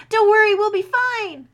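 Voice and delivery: very high, loud yell over explosions; shouting reassurance. Acted. Soldier yells reassurance to frightened unicorn. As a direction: yell it so she hears you over the blasts.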